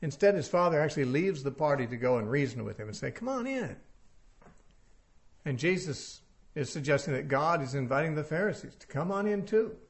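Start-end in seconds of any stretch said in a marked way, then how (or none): 3.1-3.8 gentle coaxing. Acted. Father coaxing son to come in.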